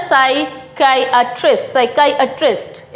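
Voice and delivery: "साइकियाट्रिस्ट" as sai-KAI-uh-trist, slow; "साइकियाट्रिस्ट" with a long kai, then uh-trist; correct pronunciation. Shown as a demonstration. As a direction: model "साइकियाट्रिस्ट" slowly, syllable by syllable. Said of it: "किस तरीके से बोला जाता है?".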